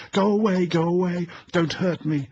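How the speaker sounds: put-on high, loud shriek of a frightened child; child's terrified scream. A narrator's reading. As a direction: scream it like a small child begging. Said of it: "it screamed".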